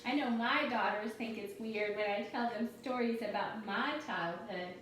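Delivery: usual speaking voice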